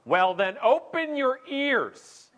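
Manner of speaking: slow, high, loud imitation; mock scolding. Imitating flight attendant telling off passenger, slow loud scolding. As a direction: stretch it out as an exaggerated rebuke. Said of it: "she looks me in the eye and goes".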